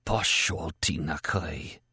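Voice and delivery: slow, soft whispered curse. Acted. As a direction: whisper it slowly, each O drawn out. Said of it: "heard himself whisper".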